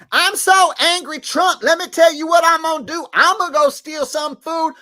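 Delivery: high, loud parody of an angry rant; hammed-up fury in a squeaky voice; mock anger. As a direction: mockingly rage in a high put-on voice. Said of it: "doesn't seem like she's that upset".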